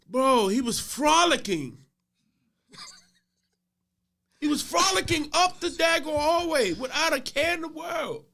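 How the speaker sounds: loud, high, incredulous exclaiming; amused outrage. Real, not acted. Custodian describing the boy frolicking up the hallway, amused outraged shouting. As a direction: cry it out in comic disbelief.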